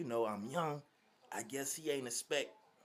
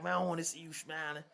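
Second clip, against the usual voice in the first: raised, quick stern mentor voice; Gramps scolding; 6 semitones higher, faster than usual. Retold or imitated. Gramps tells the laughing kid to stop smiling, raised and quick. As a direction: clip it out fast like a tough coach.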